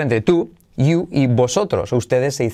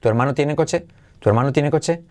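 "tu hermano tiene coche" run together as one question; quick, natural linked "tu hermano tiene coche"; flowing question with a rise on "coche"; joined up; 2 semitones higher, faster than usual. Shown as a demonstration. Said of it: "se une todo como en español".

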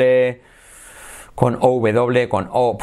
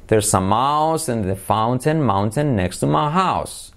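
slow, evenly drawn-out words; slow model sentence; slower than usual. Shown as a demonstration. Teacher giving the mouse sentence last, slowly. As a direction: stretch the vowels for a learner.

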